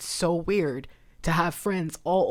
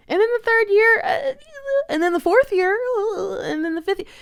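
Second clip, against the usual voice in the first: crying; 7 dB louder than usual. Real, not acted. Counting years of missed cards.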